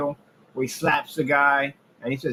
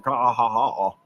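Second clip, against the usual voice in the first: mocking laugh. Real, not acted. Laughing at rivals' campaign.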